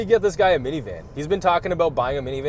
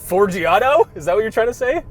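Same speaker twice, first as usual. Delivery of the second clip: incredulous; 6 semitones higher, 4 dB louder than usual. Real, not acted. Correcting friend's mispronunciation.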